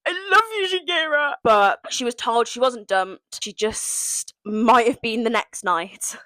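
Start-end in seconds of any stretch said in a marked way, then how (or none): 0.0-1.3 gushing love. Real, not acted. Gushing declaration to contestant.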